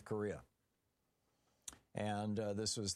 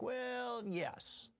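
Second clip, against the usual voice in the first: hint of a hesitant Trump; mock Trump; 12 semitones higher than usual. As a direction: stretch the well up high, caught stalling. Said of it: "getting ready to tell a lie".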